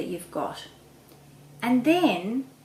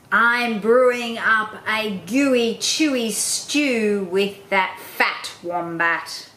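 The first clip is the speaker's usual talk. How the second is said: slow, louder, relishing villain voice; sly dingo voice; 5 dB louder, much slower than usual. Acted. Dingo boasting to the platypus about his stew, slow and sly. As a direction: savour every word with a toothy grin. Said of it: "replied Dingo with a toothy grin".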